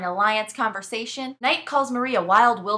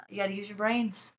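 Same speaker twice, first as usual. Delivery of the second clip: usual speaking voice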